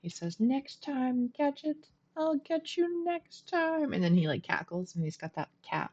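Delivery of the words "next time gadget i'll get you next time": high, slow cartoon-villain gloat; Doctor Claw. Streamer hams up the cartoon villain's threat for chat, high and slow. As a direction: draw out the threat like a scheming cartoon baddie. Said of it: "Just pretend I was making, like, Inspector Gadget, the evil guy".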